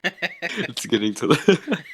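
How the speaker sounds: cracking up mid-quote; high, slow words breaking into laughter; laughing. Real, not acted. Repeating the comedian's joke to friends, laughing.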